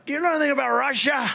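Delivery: a retold aide rushing his question; imitating Dave Bossie. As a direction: ham up an eager aide asking fast. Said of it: "Dave Bossie would come up".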